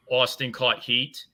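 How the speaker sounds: normal talking voice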